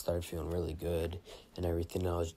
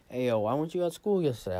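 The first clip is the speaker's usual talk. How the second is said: classmate asking; 5 semitones higher, 5 dB louder than usual. Retold or imitated. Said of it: "this bitch is like".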